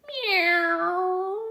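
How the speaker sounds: high, drawn meow; cat meow. Acted. Cat greeting the others with a high meow. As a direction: purr out a dainty meow.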